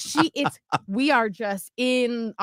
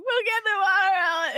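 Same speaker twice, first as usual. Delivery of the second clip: high, whimpering mock sob; retold crying; 9 semitones higher than usual. Mom crying to her daughter, tearful. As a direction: re-enact giving in through tears, higher. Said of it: "I'm crying and I'm like".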